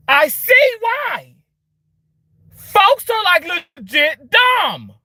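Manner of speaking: high, loud and slow; frustrated shout. Real, not acted. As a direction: drag it out in disbelief. Ranting about dumb people.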